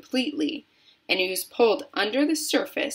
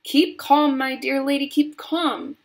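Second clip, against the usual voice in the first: higher, insistent soothing cry; raised, emphatic plea for calm; cried reassurance; 6 semitones higher, 4 dB louder than usual. A narrator's reading. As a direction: call out to calm a frantic lady. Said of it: "cried Mister Wonka".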